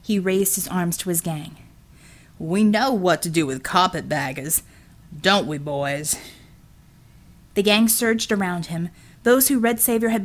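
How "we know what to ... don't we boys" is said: lower, menacing Southern sneer; a brute gloating over a beaten woman. Acted. Villain rallies gang.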